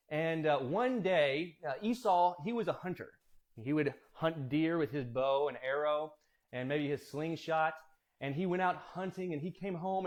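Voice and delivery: usual speaking voice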